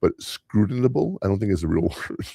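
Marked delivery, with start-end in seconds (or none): none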